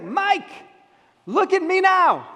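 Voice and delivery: high, loud joyful call, drawn out; excited shout. Retold or imitated. Healed man calling across to Mike, loud and joyful. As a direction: shout it to your friend, overjoyed.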